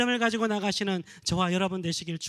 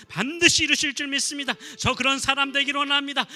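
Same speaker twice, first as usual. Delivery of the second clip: loud, high climactic preaching; preaching at full force; 3 semitones higher, 5 dB louder than usual. Acted. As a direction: drive the final appeal home, soaring. Closing climax of sermon. (힘차게 외치는 설교 절정)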